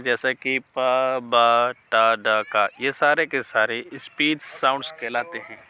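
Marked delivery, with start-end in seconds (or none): 0.0-2.4 पा, बा, टा, डा each said alone, crisp and loud; teacher sounding out पा, बा, टा, डा one by one; demonstrating speech sounds. Shown as a demonstration. Teacher demonstrating sounds to students, sounding out पा बा टा डा clearly. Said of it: "अब स्पीच साउंड कौन कौन से हैं".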